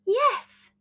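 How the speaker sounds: high eager yes; cheerful cry. A narrator's reading. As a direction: welcome the bird in. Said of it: "cried the witch".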